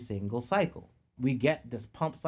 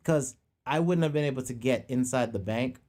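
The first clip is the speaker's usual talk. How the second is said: ordinary talk, nothing marked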